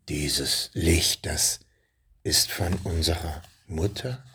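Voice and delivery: leiser, tiefer, zögerlich fragend; nicht mehr dröhnend. A narrator's reading. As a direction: der Drache wird ganz leise und unsicher. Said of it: "nicht mehr so dröhnend". (leise, tiefe Drachenstimme)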